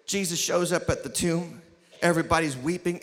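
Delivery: ordinary talk, nothing marked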